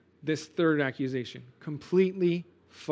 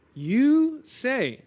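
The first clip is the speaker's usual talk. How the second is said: "You" hit higher and louder than "say"; emphatic you; 7 semitones higher, 4 dB louder than usual. Shown as a demonstration. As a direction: lean hard on "You". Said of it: "you" here is also emphatic".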